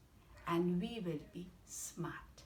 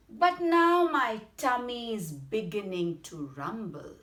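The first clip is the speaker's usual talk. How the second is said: loud, raised, emphatic storybook boast; bold mouse threat; 4 semitones higher, 7 dB louder than usual. Acted. Mouse scaring the Gruffalo with its hunger, boldly menacing. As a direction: announce hunger loudly, higher than usual.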